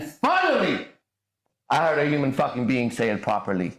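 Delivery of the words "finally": high, slow, relieved finally; finally, at last; drawn-out finally. Real, not acted. Relief at hearing it said right.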